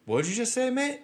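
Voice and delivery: high-pitched imitation of an Irish driver; affronted Irish cabbie. Cabbie turning on his passenger after stopping dead, affronted. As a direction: challenge him in a put-on Irish voice.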